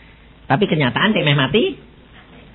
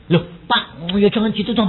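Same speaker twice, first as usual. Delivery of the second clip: usual speaking voice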